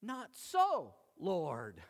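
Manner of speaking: lightly voiced stubborn refusal; refusing. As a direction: toss off a defiant no to the master. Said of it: "you cannot say".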